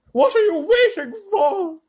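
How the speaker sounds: high, loud screech, slowed; screaming grandma. A narrator's reading. Grandma Josephine screams at Mr Wonka in terror, high shriek. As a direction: shriek it like a terrified old woman. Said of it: "screamed Grandma Josephine".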